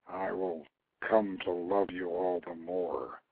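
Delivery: low, slow and hushed, a lover's confession. Acted. A character quietly confides his love and fear, low, slow and hushed. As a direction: drop the voice low and slow, tender and wary. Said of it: "in a lower voice he added".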